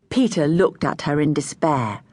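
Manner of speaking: usual speaking voice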